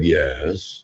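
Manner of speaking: a soft low yes from the inner voice; quiet answering self. Acted. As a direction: answer back softly, half-bothered. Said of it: "And myself said".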